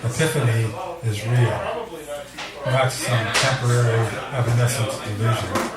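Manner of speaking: measured, solemn recital; poem reading. Acted. Poet reading his last poem to friends, solemn and measured. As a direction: let the words hang in the dark.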